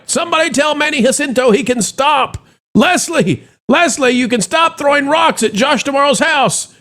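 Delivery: high theatrical eulogy voice; mock proclamation. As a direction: proclaim the death like a town crier. Mock funeral announcement, sarcastic proclamation.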